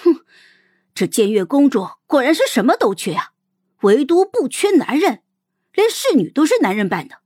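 cold huff, then mocking, swooping high pitch; snide sarcasm. Xiao girl sneering at the princess and her disguised maid, snidely sarcastic. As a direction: needle them with exaggerated sarcasm. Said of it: "阴阳怪气的就说道".

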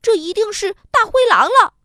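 startled little rabbit; frightened thought. A narrator's reading. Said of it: "心里想". (小兔子有点惊慌地想)

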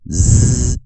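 Z said loud and buzzing; Z sound demo. Shown as a demonstration. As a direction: model the Z sound firmly. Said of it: "它就是发出".

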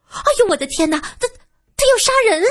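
慌乱地喊，最后一句音调猛然拔高; 吓坏了冲口而出地叫; 惊慌大喊. A narrator's reading. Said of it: "苏三慌忙喊道". (苏三看见有人掐脖子，惊慌高喊)

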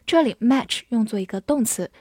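ordinary talk, nothing marked

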